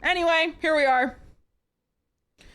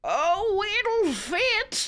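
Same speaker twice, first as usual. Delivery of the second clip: mock husky voice; 8 semitones higher, much slower than usual. Reading a man's line.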